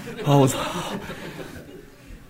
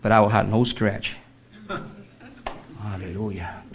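ordinary talk

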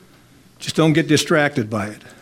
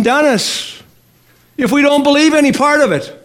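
raised, fervent preaching voice; 7 semitones higher than usual. Acted. Preacher urging the congregation to believe, fervent.

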